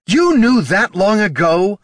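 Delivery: loud, high, slow accusation; furious drawn-out question; thunderous anger. Acted. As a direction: thunder it out in outrage. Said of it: "Thunder roared in Katha's voice".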